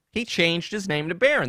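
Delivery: normal talking voice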